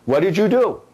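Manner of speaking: mock scolding owner, What did you do rising; teacher voicing the scolding she gives her dogs. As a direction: ask the dog what it did. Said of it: "asking my doggies that".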